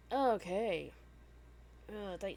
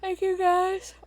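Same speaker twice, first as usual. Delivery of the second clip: talking through a big yawn; 18 dB louder, slower than usual. Real, not acted. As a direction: yawn the words out, higher and loud. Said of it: "Oh, I'm yawning".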